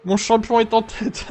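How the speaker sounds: high, slow mock cheerleader cheer. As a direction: cheer like a pom-pom girl. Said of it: "la pom-pom girl, je t'imagine bien avec tes pompons".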